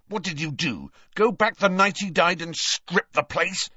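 tense, accusing anger; snarling. A narrator's reading. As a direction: snarl the accusation through clenched teeth. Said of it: "snarled Harry".

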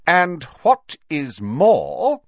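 slow, loud deliberate opener; awful finality. A narrator's reading. McGonagall ending the argument, grave finality. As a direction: lay down the last word. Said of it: "with an air of awful finality".

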